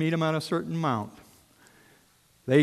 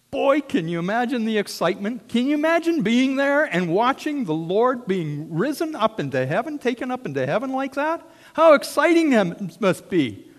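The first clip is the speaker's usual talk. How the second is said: higher and louder, full of wonder; excited; 9 semitones higher, 6 dB louder than usual. Real, not acted. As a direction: lift them up with your own joy. Preacher building excitement.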